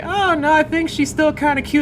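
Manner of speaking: high, light mock-cute voice; playful high-pitched reassurance; mock sweet voice. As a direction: defend her in a sugary high voice. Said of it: "I'm just like".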